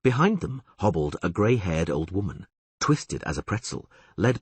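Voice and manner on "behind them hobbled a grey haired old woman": usual speaking voice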